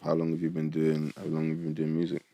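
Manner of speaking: ordinary delivery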